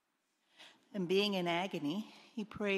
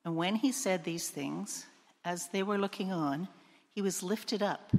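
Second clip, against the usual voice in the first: normal talking voice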